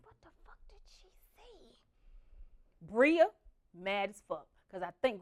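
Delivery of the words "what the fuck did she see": hushed unvoiced whisper. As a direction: whisper so grandma can't hear. Whispering in bed at night.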